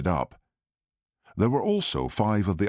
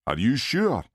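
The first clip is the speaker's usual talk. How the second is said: sharp Glasgow-voiced question; 6 semitones higher than usual. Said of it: "Blair said sharply".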